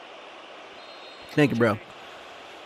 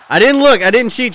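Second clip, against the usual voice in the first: high, loud protest; urgent raised-voice denial; excited insistence; 5 semitones higher, 5 dB louder than usual. Real, not acted. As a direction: burst out defending your prediction to the chat. Insists to chat they predicted it.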